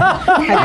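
short amused laugh, high pitched. Real, not acted. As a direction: burst into a laugh. Laughs at own joke.

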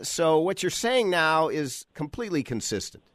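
normal talking voice

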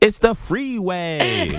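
hammed-up Valley Girl; Valley Girl accent. Asked for a California voice, hammed-up Valley Girl. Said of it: "like the Californians".

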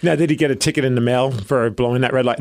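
ordinary talk

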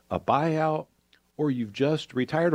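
ordinary delivery

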